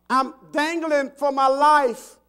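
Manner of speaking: drawn-out acted cry for rescue; yelling for help. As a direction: holler it from a cliff edge. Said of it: "he yells out".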